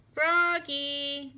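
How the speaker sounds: father calling his son from afar. A narrator's reading. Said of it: "called his father".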